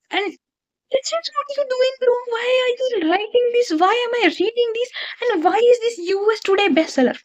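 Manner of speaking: high-pitched exasperated rant; real exasperation. Real, not acted. Ranting at the author in disbelief.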